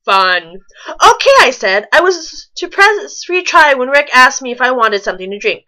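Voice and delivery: normal talking voice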